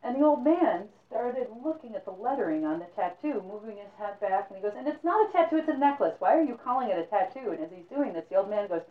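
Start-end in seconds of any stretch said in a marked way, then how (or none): 4.8-7.5 protesting. Acted. Hero protests to old man.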